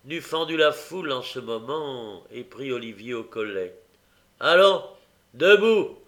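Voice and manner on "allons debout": loud high gruff command; rough order. Acted. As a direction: bark it at the boy. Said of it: "dit-il rudement".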